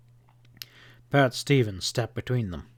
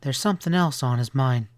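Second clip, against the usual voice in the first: ordinary delivery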